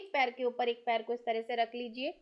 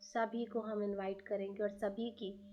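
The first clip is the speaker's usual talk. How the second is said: guided meditation; 2 semitones lower than usual. Acted. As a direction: lead listeners gently inward. Guided meditation invoking gurus.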